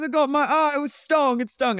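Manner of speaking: very high mock wail, held up top; hammed-up complaint of pain in a high voice; imitated wailing. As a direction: wail it way up high, mocking him. Retelling James's pained outcry.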